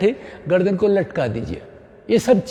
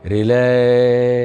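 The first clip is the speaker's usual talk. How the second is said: soothing relax; 6 semitones lower, much slower than usual. Shown as a demonstration. Yoga teacher releasing pose.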